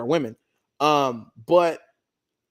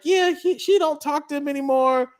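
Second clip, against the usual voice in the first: higher mom voice; imitating her mom; 9 semitones higher than usual. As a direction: play the meddling mother. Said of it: "And she's like".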